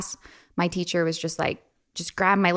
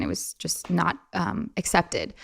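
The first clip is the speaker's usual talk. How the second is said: ordinary delivery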